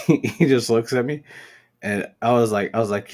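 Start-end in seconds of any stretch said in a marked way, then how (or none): none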